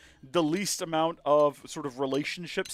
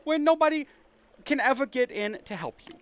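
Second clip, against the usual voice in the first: high, hammed-up New York gangster menace; put-on gangster accent; 6 semitones higher than usual. Gad threatening Powell with a private room, gangster accent. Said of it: "like a gangster".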